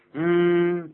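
long, loud, high "mmm" of pondering; demonstrative hesitation sound; thinking hum. Shown as a demonstration. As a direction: draw out a hum as if considering. Demonstrates well as hesitation filler.

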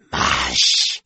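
lightly voiced driver's call; mild gruff call to the eagles. A narrator's reading. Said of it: "cried the man with the beard".

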